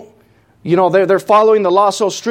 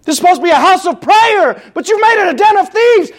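much higher and louder, driving them out; yelling; 14 semitones higher, 5 dB louder than usual. Retold or imitated. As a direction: shout it like chasing them from the temple. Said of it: "I'm sure he was yelling at them".

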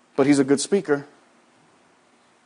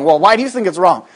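ordinary talk

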